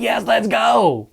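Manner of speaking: high, slow, drawn-out cheer; high happy shout, stretched long; excited yell. Retold or imitated. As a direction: yell it out to the empty park. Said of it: "I'm just yelling out".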